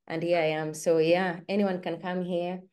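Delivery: normal talking voice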